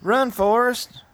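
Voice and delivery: high, drawn-out mock call; movie quote impression. Quoting Forrest Gump to co-hosts, stretched high calling voice. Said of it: "It's like Forrest Gump coming up".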